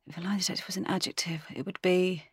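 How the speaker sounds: very soft, quick self-prompt; thinking aloud. Real, not acted. Guest repeats the game question to herself, thinking aloud. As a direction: murmur the question back to yourself.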